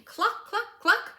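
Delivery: very high, loud hen clucking; squawking hen clucks. Acted. Reading a picture book, the hen clucks, very high and loud. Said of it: "Hen came running".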